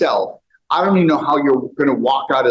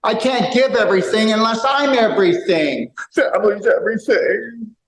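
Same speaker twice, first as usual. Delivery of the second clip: mocking voice; 8 semitones higher than usual. Mocking a belief in a put-on voice.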